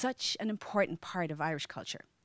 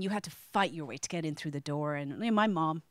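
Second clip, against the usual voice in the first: ordinary talk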